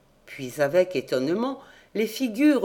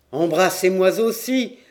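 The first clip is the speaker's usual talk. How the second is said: exclaiming; 5 dB louder than usual. A narrator's reading. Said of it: "s'écria le roi".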